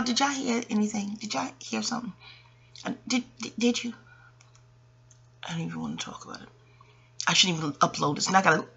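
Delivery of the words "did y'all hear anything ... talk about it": quiet, higher nervous question; hushed check with viewers about a sound; hushed worry. Real, not acted. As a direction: ask it half under your breath, uneasy. Worried the video caught something.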